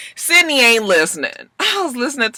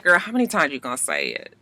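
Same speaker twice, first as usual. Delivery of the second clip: aside to the screen; 8 semitones lower, 8 dB quieter, faster than usual. Retold or imitated. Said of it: "I said".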